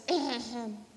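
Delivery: hesitant mock throat clear. Turtle about to admit being wrong, clearing throat.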